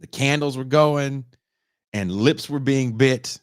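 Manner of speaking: loud slow swaggering braggart voice; cocky mock boyfriend. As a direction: brag it loud and slow, full of himself.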